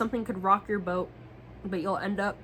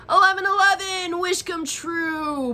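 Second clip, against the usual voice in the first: excited number; 10 semitones higher, 6 dB louder than usual. Real, not acted. Excited card reading.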